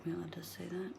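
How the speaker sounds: hushed whisper aside. Real, not acted. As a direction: whisper it under your breath.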